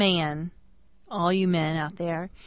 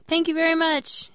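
much higher, overly sweet thank-you; high polite thanks; 9 semitones higher than usual. Retold or imitated. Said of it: "And I was like".

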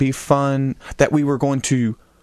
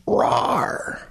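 rawr growl. Retold or imitated. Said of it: "sent us a message".

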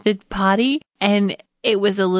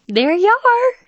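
much higher, louder sing-song; bright high mom voice; 5 dB louder, slower than usual. Retold or imitated. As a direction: chirp it up high and cheerful. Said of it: "I'm like".

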